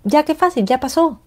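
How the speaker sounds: high airy "Ay, ya qué fácil"; carefree mock shrug; breezy dismissal. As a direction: brush it off as if it were nothing. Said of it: "Entonces digo".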